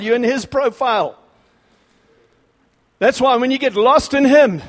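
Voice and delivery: loud, high, rising fervour from the pulpit; preaching at full force. Real, not acted. Preacher firing up his congregation, full-force preaching. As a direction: thunder it out like a revival preacher. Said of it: "I'm getting carried away".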